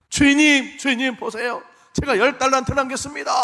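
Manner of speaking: loud, high, eager call; proud servant's shout. Pretend. Servant calling out proudly to his master, shouting. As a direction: burst in to show off the profit.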